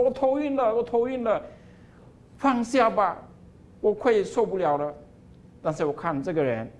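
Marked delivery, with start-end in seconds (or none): 0.0-4.9 high, desperate begging; dizzy pleading. Acted. Cup spinning on the wheel begs the potter, high and desperate. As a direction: beg to stop, you can't take more.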